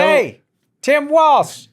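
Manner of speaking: very high, loud mock street holler; newsboy shout. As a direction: holler it like a newsie. Newsboy shouting headline name.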